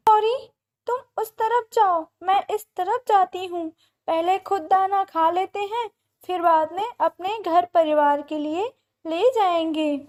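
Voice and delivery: high, loud storybook bird voice; eager little bird. A narrator's reading. Bird telling Gauri how to share the search, eager. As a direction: lay out the plan in a high, forceful voice.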